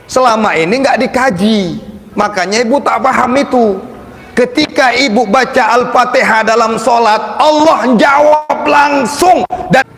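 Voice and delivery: rising high and loud to a shout; fervent preaching. Acted. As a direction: build the sermon to a climax. Preaching at full force.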